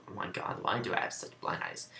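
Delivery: quick whisper under her breath; whispered aside. Real, not acted. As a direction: mutter it fast to yourself. Exasperated aside to herself.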